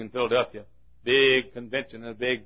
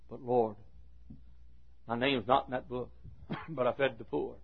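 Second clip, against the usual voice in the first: subdued protest; 9 dB quieter than usual. Acted. Dives answering God at the judgement, subdued protest. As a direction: offer the defence quietly. Dives pleads his case.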